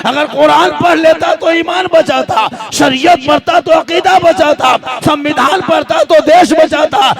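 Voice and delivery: loud, high rousing sermon; fiery preaching. Real, not acted. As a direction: rouse the congregation. Preaching at full force. (जोशीला, ऊँचा और ज़ोरदार भाषण)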